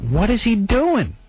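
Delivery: drawn-out incredulity; baffled. Retold or imitated. Retold baffled reaction.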